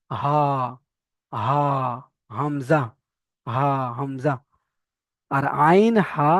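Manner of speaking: হা and হামজা breathed from deep in the throat; deep from inside. Shown as a demonstration. Teacher showing students where হা starts, breathy. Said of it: "একদম ভিতর থেকে আসবে".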